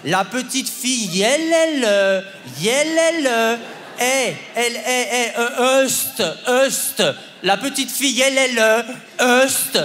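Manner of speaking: elle, elle repeated slowly and stretched; syllable-by-syllable drilling. Retold or imitated. Mother forcing her son through the dictation, syllable by syllable. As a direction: hammer each syllable for the child.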